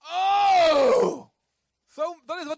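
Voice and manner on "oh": high, loud drawn-out "Oh"; crying out. Acted. Preacher demonstrating how God says it, crying out. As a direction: wail it out as an anguished call. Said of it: "Hij zegt dit".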